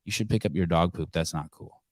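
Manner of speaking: soft, quiet, steady; a calm word to the dog owner. Shown as a demonstration. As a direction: gently tell her off. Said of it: "I say to that girl".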